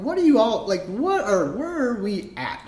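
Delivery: high, puzzled mock voice; confused onlooker. Outsiders looking at the flock, high confused voice. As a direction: play a lost outsider staring in. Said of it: "they're like".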